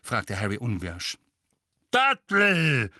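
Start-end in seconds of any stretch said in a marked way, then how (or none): 1.9-2.9 loud booming demand; father booms about his son. A narrator's reading. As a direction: boom it across the kitchen. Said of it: "dröhnte Onkel Vernon".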